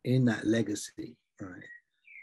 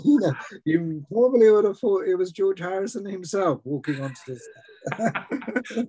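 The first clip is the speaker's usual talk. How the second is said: words spilling out through laughter, louder; laughing while talking; 8 semitones higher, 6 dB louder than usual. Real, not acted. Host laughing as he praises the [name] capsules, amused. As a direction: chuckle through it, amused and delighted.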